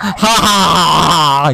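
loud, slow "ha ha ha"; drawn-out exaggerated fake laughter; forced nasty laugh. Streamer laughing at the joke, forced loud cackle. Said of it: "Pis bir gülüş at".

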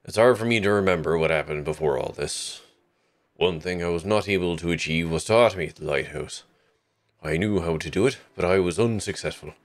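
put-on lightly Newfie-ish old sailor voice; salty old voice. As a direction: read the note as a weathered old lightkeeper. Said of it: "a salty old voice for this".

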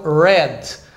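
red with a curled caipira R, slow; red, the R curled back like a caipira; English R. Shown as a demonstration. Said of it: "igual do caipira".

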